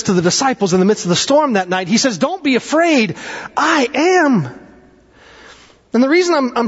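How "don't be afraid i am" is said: high, drawn-out proclamation; ringing preacher's declaration, pitched up; calling out. Retold or imitated. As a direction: proclaim it high over a storm. Jesus calling to disciples in storm.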